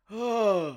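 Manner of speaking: sharp gasp at a glimpse; shocked gasp. Real, not acted. Co-host peeking at the rude image, shocked gasp. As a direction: gasp as if you peeked too soon.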